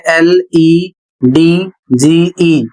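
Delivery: letters spelled slowly one by one; slow spelling out. Shown as a demonstration. Teacher spelling handsome slowly for the students. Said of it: "spelling out the word".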